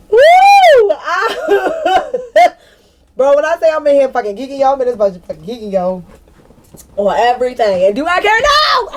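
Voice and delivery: high whooping, fast and loud; real giddy shouting; loud excitement. Real, not acted. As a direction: let it all out, hyped. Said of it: "I'm sorry, I'm loud".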